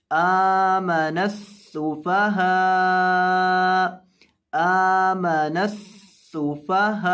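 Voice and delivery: आमन सुफहा with the long vowel held for three counts; slow drawn-out recitation of आमन सुफहा; stretched vowels. Shown as a demonstration. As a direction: draw out आमन सुफहा slowly, Quran style. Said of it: "मद करनी है तीन अलिफ के बराबर".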